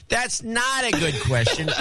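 high, slow John C impression. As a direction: yell it like John C. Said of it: "in my best John C voice".

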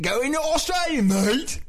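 high-pitched, exaggerated Australian accent; hammed-up Crocodile Dundee Australian; mock Aussie accent. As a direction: imitate a tourist's terrible Aussie accent. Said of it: "Whenever people talk about Australia".

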